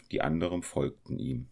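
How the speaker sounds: plain reading voice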